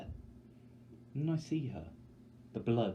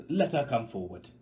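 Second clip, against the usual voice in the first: louder, commanding; firm mayor; 3 semitones higher, 8 dB louder than usual. Acted. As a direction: close the matter with authority. The mayor decides.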